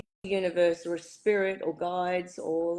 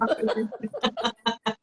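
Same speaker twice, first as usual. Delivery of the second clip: high bright laugh; 4 semitones higher than usual. Real, not acted. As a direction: burst into laughter. Laughs at own story.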